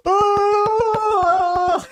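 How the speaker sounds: very high, loud chest-thumping King Kong yell; mock roar. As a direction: bellow like a proud giant ape. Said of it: "and then he goes".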